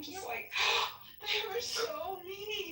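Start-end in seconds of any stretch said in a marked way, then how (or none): 0.6-1.2 sharp high gasp of hurt; acted wounded gasp; tearful gasp. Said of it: "I will cry on behalf of others". 1.2-2.7 high, slow tearful whimper; put-on weepy voice; acted crying. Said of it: "I will cry on behalf of others".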